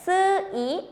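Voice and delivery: "sui" said slowly, split as su... i; slow pronunciation. Shown as a demonstration. Teacher breaks down a hard syllable for learners, slowly. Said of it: "si pronunciamos despacito es".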